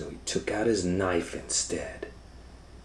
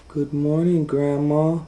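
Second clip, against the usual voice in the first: put-on high, loud little girl greeting; 11 semitones higher, 10 dB louder than usual. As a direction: chirp it brightly like a small girl. Little girl voiced by narrator.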